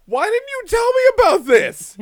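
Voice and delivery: high, loud delighted outrage; cat discovering the bathtub; high amazed cat. Retold or imitated. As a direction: squeal with astonished joy.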